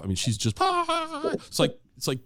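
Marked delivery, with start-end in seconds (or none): 0.6-1.3 high, sustained mock shriek at moderate volume; imitated fangirl scream. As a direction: imitate a teenage daughter screaming over her idol. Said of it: "she's screaming her head off".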